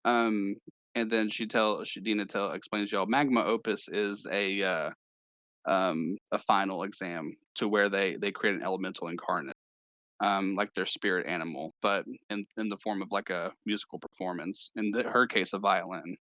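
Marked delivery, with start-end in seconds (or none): none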